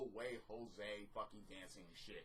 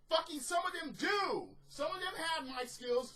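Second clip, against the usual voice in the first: much higher, louder indignant protest; indignant outburst; 13 semitones higher, 6 dB louder than usual. Real, not acted. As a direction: snap back in their defence. Angry rebuttal in rant.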